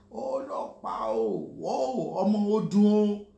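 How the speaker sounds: hammed-up street call ending loud on ọmọ odun o; mock catcaller. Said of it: "You're looking at something".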